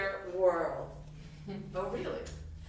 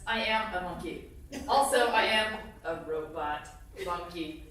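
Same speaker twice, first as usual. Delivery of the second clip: ordinary delivery, nothing marked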